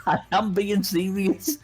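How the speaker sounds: high, drawn-out comic insistence; playfully protest, stretching it out; hammed-up wounded insistence; mock indignant protest. Mimics Luffy protesting again.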